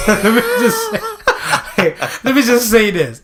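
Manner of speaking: high, loud words through real laughter; laughing. Real, not acted. Reacting to [name]'s comeback, laughing hard. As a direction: crack up while trying to speak.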